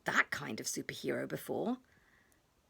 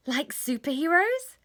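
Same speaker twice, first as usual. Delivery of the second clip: high, louder eager question rising on "superheroes"; a hopeful kid asking; eager child question; 6 semitones higher, 4 dB louder than usual. Acted. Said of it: "said William".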